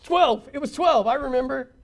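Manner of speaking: high, excited know-it-all voice; an overeager pupil blurting the answer; mock keen schoolboy; eager student. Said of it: "the eager student in the back of the room going".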